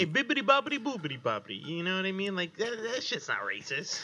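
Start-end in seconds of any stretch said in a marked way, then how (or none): 0.0-1.7 high, fast mock Italian babble. Friend defending Italian accents, fast mock Italian babble. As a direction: rattle off nonsense in a hammed-up Italian accent.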